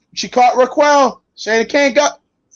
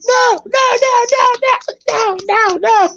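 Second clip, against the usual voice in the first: much higher, frantic repeated no; panicked pleading; slower than usual. Retold or imitated. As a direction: squeal like a man about to get hurt. McMahon voiced panicking in sketch.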